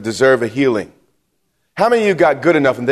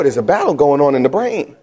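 normal talking voice

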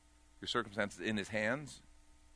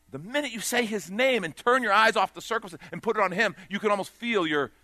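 louder, higher preacher's push; preaching at full force; 9 semitones higher, 7 dB louder than usual. Acted. Preacher urging listeners to stop controlling, preaching at full force. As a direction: lift the room with it.

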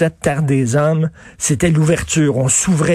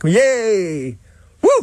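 mock cheer; 4 semitones higher than usual. Mock celebration of victim card.

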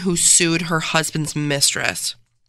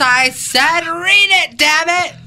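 high, loud, drawn-out command; mock angry shout; 11 semitones higher, 5 dB louder than usual. Lawman ordering helper to read, hammed-up shout. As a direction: bellow it like a hammy film tough guy.

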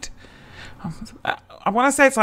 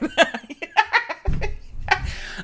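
helpless high-pitched laughter; high giggling laugh; 5 dB quieter than usual. Real, not acted. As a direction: crack up at your own joke. Laughs at own scenario.

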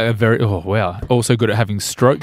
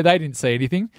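usual speaking voice

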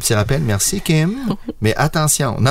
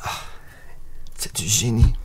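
whispered aside; whispered admiration; 5 semitones lower, 7 dB quieter than usual. Real, not acted. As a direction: breathe it as a soft secret.

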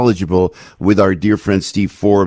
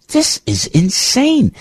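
much higher and slow, drawn out; exasperated; 13 semitones higher, much slower than usual. Real, not acted. Host exasperated at car trip limits, slow and high. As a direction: stretch it in disbelief.

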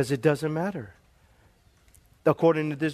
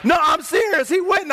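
insistent shout; 10 semitones higher, 4 dB louder than usual. Real, not acted. Preacher insists to laughing congregation.

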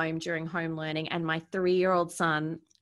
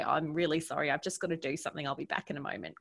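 apologetic, quieter; 3 semitones lower, 6 dB quieter, faster than usual. Retold or imitated. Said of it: "I had to say".

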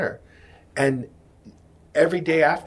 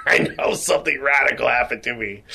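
laughing through it. Real, not acted. Man retelling his answer to his wife, breaking into laughter.

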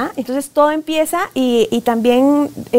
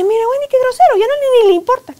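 much higher, outraged mock complaint; exasperated parent; 12 semitones higher, 4 dB louder, faster than usual. A parent complaining to Wendy about her rude child, high mock outrage. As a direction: whine it like a fed-up mother telling on her son.

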